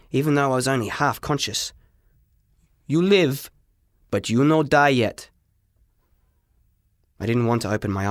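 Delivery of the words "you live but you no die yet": broken English. Acted. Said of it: "I could hear him talking".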